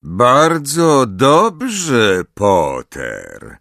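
slow, drawn-out menacing drawl; slow motion speech. A narrator's reading. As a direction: stretch every syllable menacingly. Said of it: "przeciągając sylaby".

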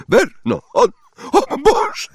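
very high, strangled shriek; choked horror. A narrator's reading. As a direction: gasp out in shrieking panic. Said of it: "wydała kilka dźwięków charakterystycznych dla osób, które się krztuszą". (zduszony, piskliwy okrzyk przerażenia)

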